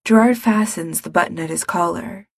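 usual speaking voice

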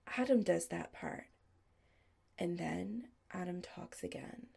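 plain reading voice

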